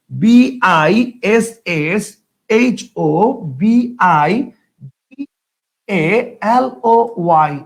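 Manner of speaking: very slow, letter by letter; spelling out. Shown as a demonstration. Teacher writes the word in Banglish for students, spelling it out slowly. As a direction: leave space round each letter as if writing it down. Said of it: "বাংলিশে লিখে ফেলতেছি".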